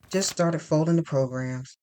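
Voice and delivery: ordinary delivery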